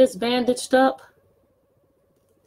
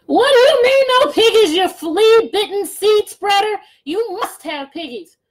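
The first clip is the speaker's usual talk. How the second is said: much higher, loud furious outburst; angry witch shout; 10 semitones higher, 8 dB louder than usual. Acted. Gritch yelling at the farmer, much higher and loud. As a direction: scream at the farmer.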